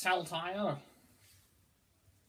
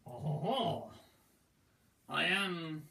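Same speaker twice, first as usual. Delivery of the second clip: put-on French accent. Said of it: "No, I'm not talking about my accent".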